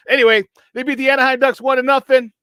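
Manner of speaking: loud, proud score call; excited announcement. Real, not acted. Host announcing the Blackhawks beat the Ducks, loudly proud. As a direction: call the result with pride.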